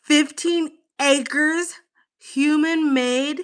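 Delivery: slow, high amazement; slow, high, marvelling read; awed reading. Real, not acted. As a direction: savour each word in wonder. Reads fact in awe.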